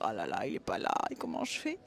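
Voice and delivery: a hammed-up despairing sigh; mock self-pity. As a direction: play the moping ex-lover. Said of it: "et on va dire".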